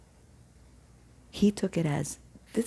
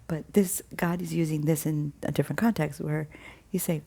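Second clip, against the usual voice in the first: ordinary talk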